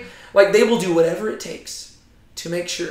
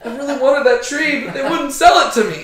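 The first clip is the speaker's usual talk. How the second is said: higher, whiny mock lament; mopey president; 4 semitones higher, 6 dB louder than usual. Imagined president whining about a tree, hammed-up. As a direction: sulk like a spoiled kid denied a toy. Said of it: "saw Joe Biden just, like, curl down".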